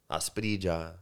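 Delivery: usual speaking voice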